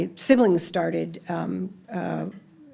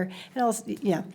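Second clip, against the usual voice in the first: ordinary talk, nothing marked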